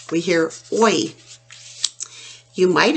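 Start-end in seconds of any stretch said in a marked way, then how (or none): none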